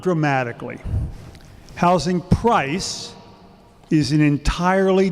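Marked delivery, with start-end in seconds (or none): 1.7-3.1 "Housing price" said slowly and deliberately, each word set apart; term spelled out slowly. Shown as a demonstration. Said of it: "a couple of vocabulary things".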